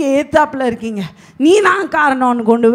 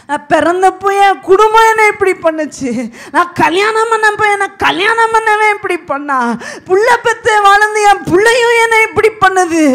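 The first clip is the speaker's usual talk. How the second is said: higher, louder tearful wail, imitated; mock lament; 7 semitones higher, 5 dB louder than usual. Pastor mimics a woman bemoaning her family, mock sobbing. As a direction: play the self-pitying woman crying out her fate.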